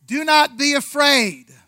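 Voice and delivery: slow, grand angel proclamation of "Do not be afraid."; booming angel. Shown as a demonstration. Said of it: "I wonder if they get tired of that line".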